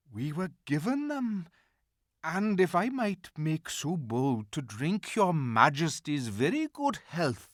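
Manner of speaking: a hint of a nervous fox; courteous and a little high; polite old fox. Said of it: "said the fox".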